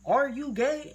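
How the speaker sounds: high, loud retold point-blank question; blunt question. Mom confronting her child straight up, imitated blunt question. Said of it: "straight up and just say".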